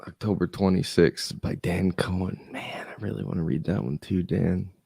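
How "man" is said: ordinary delivery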